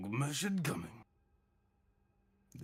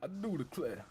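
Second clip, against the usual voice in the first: put-on old southern gentleman; 4 semitones higher than usual. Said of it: "talk like an old southern slave owner".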